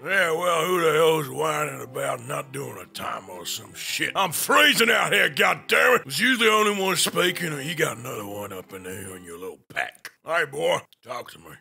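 put-on Western cowboy accent, gruff and teasing. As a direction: play the old-West cowboy mocking a name. Holds a cowboy voice all along.

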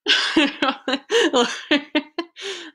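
long amused laugh; real laugh. Real, not acted. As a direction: burst out laughing at the story. Reader laughs at the story.

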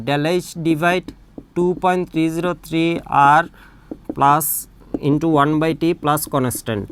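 slowly spelled out term by term; slow dictation. Shown as a demonstration. Teacher dictating the mistaken equation, very slow. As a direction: read it out slowly for note-taking.

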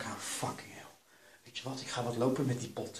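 usual speaking voice

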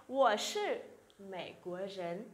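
slow, careful Mandarin answer; slow model reading. Shown as a demonstration. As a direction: spell it out slowly for learners. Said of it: "chúng ta sẽ nói là".

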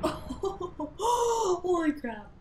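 drawn-out, high, strained holy crap; stunned disbelief. Real, not acted. Watching a player about to fall, stunned and drawn out. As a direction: stretch it out in shock.

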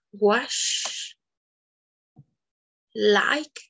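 each word said slowly alone, ending rising; clear word list. Shown as a demonstration. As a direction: model each English word for students. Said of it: "For example, we have". (cada palabra en inglés pronunciada claramente)

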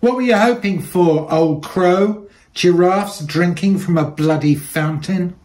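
put-on high, loud jeering yell at an old woman; mocking shout. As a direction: shout it down from the ramp, taunting. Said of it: "One shouted".

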